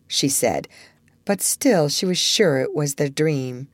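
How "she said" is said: usual speaking voice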